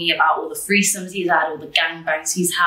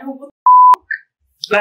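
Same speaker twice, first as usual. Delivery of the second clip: loud, drawn-out shocked yell, higher up; 9 semitones higher, 10 dB louder, slower than usual. Retold or imitated. A random schoolmate messaging her, loudly shocked. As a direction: voice a stranger's outraged reaction.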